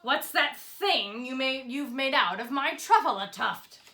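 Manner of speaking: loud, angry, puffing shout; the Lorax furious over his tuft; upset shouting. A narrator's reading. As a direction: shout and puff at the thneed. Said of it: "as he shouted and puffed".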